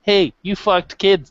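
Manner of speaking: usual speaking voice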